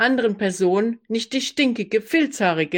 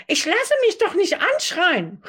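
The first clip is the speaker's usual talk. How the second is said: shrill-high hysterical cry, much higher; 11 semitones higher than usual. Acted. Roommate protesting to the staff, hysterical and high. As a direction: shriek the outrage, hysterical. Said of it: "rief meine Zimmernachbarin hysterisch".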